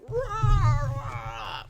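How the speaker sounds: high, loud animal roar; monster growl. Shown as a demonstration. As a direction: roar like a beast. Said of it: "like that".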